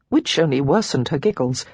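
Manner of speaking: ordinary delivery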